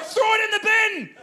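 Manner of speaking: high, frantic acted cry; panicked suggestion. Husband advising wife from toilet, panicked. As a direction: blurt a desperate fix in a high voice.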